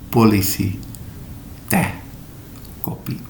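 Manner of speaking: "polisi", "teh", "kopi" with no puff of air; unaspirated p t k; dry Indonesian stops. Shown as a demonstration. Teacher contrasting dry Indonesian sounds. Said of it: "dry Indonesian sounds".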